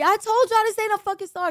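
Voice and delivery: high, loud gloating; triumphant I-told-you-so. Retold or imitated. Telling everybody she was right, high loud gloat. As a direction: crow it like you were right all along. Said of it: "I'm telling everybody".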